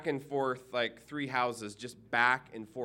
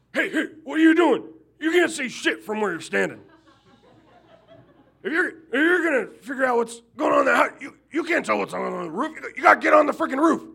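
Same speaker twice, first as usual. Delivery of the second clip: high, fast, shouted heckling; yelling at inspector; 13 semitones higher, 11 dB louder, faster than usual. Retold or imitated. Neighbor yelling at the house inspector from next door, high and loud. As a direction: heckle him at full volume. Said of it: "he yells at the inspector".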